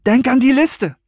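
high, loud, urgent warning; calling out. A narrator's reading. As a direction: cry out to cut them short. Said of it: "rief Serenity".

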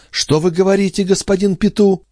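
very high-pitched alarmed question; acted female fright, pitch held high; trembling alarm. A narrator's reading. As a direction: question him in horrified disbelief. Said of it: "затрепетав".

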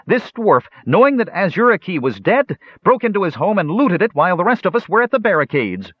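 shrill-pitched public accusation; angry accusation. Acted. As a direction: denounce the dwarf before the crowd. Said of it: "shouted the elf, unmollified".

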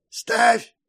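high, sharp hag command; old hag. A narrator's reading. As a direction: bark it like a crone. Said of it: "старуха ткнула в весы".

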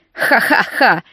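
hammed-up villain's ha-ha-ha; theatrical villain laugh. A narrator's reading. Heroine mimics villain Brody, theatrical cackle. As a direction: laugh deliberately like a stage villain. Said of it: "театрально расхохоталась, подражая злодею Броди".

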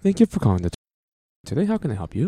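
polite phone-desk greeting; customer service voice. Pretend. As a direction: answer like a call centre agent. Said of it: "That's your customer service voice".

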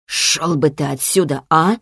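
hoarse, huffy dismissal; offended rasp. A narrator's reading. Wounded boy telling his father off, offended and hoarse. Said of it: "обиженно прохрипел Тиаро".

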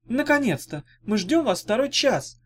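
high-pitched impatient squeal; high, peeved girlish outburst. A narrator's reading. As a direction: squeal it like an exasperated girl. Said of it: "взвизгнула румяная девушка с узелком".

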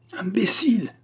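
high-pitched angry cry; stamping-mad outburst; furious yell. A narrator's reading. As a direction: yell it in a fury. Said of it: "hurla Bark en trépignant".

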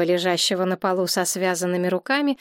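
ordinary delivery

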